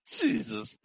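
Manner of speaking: squeaky breathless laugh; high wheezing laugh. Real, not acted. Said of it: "this is terrible".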